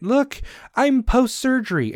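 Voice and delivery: high, showy mocking voice; a put-on bragging tone; mock flaunting voice. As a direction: parody someone showing off their surgery.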